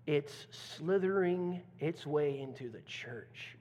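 usual speaking voice

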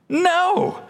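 sharp high no; emphatic no. Real, not acted. Pastor answering the congregation, emphatic high no. Preacher answers his own question.

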